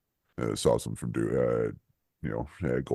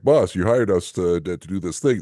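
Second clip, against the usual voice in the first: raised, louder mock protest; retold protest to boss; 6 semitones higher, 6 dB louder than usual. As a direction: henchmen complaining to the boss, lightly acted. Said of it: "But they're like".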